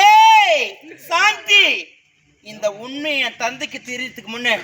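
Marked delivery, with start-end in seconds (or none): 0.1-1.7 loud, high call of the name; defiant yell. Acted. Girl turning on her sister-in-law, defiant yell.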